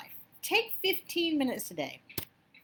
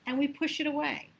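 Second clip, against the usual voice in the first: ordinary talk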